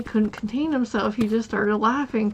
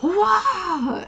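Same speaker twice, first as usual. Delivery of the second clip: mock yelp; 10 semitones higher, 4 dB louder than usual. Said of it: "and she went".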